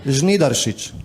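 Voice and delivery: Žnidaršič said on its own as a model, zh-NEE-dar-shich; repeated name pronunciation. Shown as a demonstration. Host repeating the surname for a guest to copy, native Slovenian pronunciation of Žnidaršič. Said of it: "say it one more time".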